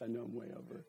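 usual speaking voice

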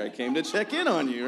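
visiting pastor telling the intern why he came, mock cheerful; pastor's reply. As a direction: mimic the pastor's cheerful answer. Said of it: "pastor's reply".

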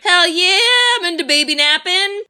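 high, loud mock glee; hammed-up eager villain; gleeful villain. Mocking the goblin king's eager reply, high and gleeful. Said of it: "[name]'s like".

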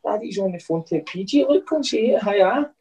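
dad cooing to his daughter Marnie during the call. Real, not acted. Dad talking to his toddler.

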